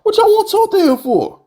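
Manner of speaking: jabbing at the former GM in a high squeaky mock voice; squeaky mocking aside. Real, not acted. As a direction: squeak it out like a sarcastic jab.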